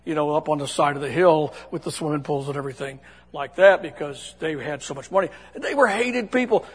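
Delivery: usual speaking voice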